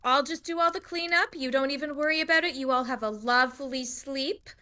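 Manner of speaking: fussing hostess, starting high and settling; doting tavern keeper. Acted. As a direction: reassure the guests as their barkeep. Dora sends guests to bed.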